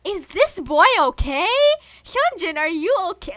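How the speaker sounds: loud, high-pitched dramatic concern; exaggerated alarm. Reacting to an idol's birth chart, exaggerated alarm. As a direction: cry it out in mock worry.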